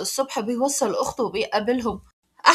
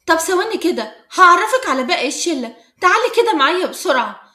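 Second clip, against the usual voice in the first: higher, louder, eager chatter; lively and insistent, pitched up; 4 semitones higher, 7 dB louder than usual. A narrator's reading. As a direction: bubbly call to come along and meet the gang. Friend eagerly drags her to group.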